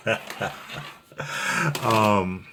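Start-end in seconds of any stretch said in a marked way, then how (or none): 1.2-1.8 a short, high laugh at his own joke; high burst of laughter. Real, not acted. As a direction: laugh it off lightly, pitched up.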